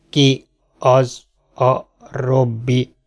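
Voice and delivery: very slow, separated robot words; halting robot voice, long gaps between words; talking robot. Acted. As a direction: machine asking word by word. Robot questions the girl.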